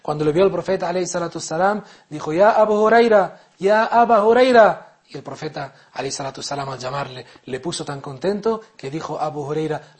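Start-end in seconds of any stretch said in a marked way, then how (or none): 2.3-5.0 high, loud call, the second slow. Acted. The Prophet calling out to hungry Abu Huraira, loud call. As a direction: call his name across a distance.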